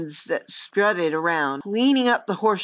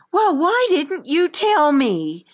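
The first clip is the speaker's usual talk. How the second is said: put-on indignant girl; 8 semitones higher, 4 dB louder, slower than usual. Girl scolds old friend.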